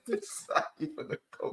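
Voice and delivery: high, hushed character voice; impression voice. Repeating the viewer's request, high hushed impression. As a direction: repeat it in a high, airy put-on voice.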